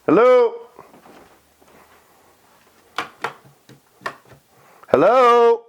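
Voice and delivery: loud high call; calling down a hallway. Real, not acted. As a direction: call out to anyone inside. Announcing self in empty house.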